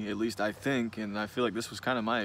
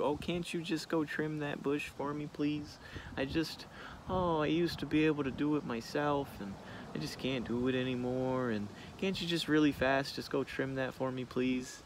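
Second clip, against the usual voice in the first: higher, softer, pleading mock-sweet voice; dainty helpless woman; 4 semitones higher, 4 dB quieter than usual. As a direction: play the fragile lady asking a favor. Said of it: "dainty little flower of a woman card".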